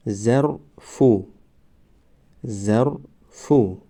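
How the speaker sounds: ظرفو stretched very slowly, syllable by syllable; drawn-out demo. Shown as a demonstration. Teacher modelling the word for students, very slow. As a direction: draw out ظرفو slowly for the class. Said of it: "ما باید همان حرف را فقط آوازش را تلفظ کنیم".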